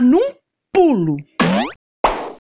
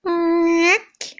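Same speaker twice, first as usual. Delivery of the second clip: high squeaky door creak; 6 semitones higher than usual. A narrator's reading. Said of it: "a porta fazer".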